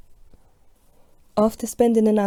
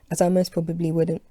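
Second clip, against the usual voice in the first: ordinary delivery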